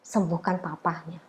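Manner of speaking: soft, near-whispered plea to God; quiet whispered prayer; hushed prayer. Retold or imitated. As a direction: pray it under your breath, tearfully tender. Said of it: "aku cuma doa gini".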